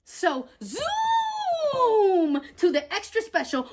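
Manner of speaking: high, loud, drawn-out zoom; excited zoom. A narrator's reading. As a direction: announce the rush with big energy. Narrator hurrying.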